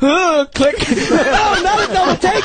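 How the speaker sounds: very high, laughing mock outrage; frantic high-pitched protest; imitated exasperation. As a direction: shriek it through laughter, hammed up. Acting out frantic slating mix-up.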